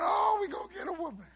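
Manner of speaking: loud, high hammed-up wail; mock crying wail. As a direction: imitate a panicked brother crying out loud. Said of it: "jumped up and started crying".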